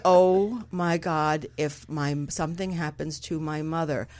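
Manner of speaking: low, slow, drawn-out thought; stoned worry. Retold or imitated. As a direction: drag out the worried question, mostly low. Acting out stoned worry about mother.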